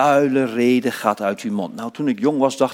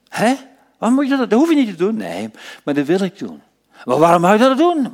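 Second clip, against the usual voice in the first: higher and louder, baffled Roman soldier; puzzled soldier; 11 semitones higher, 6 dB louder, faster than usual. Acted. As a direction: play the astonished soldier. Voiced soldier questioning disciple. (verbaasde soldaat, hoger en luider)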